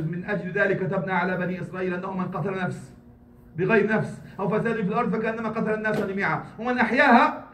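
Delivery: fast, flowing recitation of the verse; rapid Quran recitation. Acted. Imam quoting the Quran to worshippers, rapid recitation. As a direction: rattle off the verse quickly.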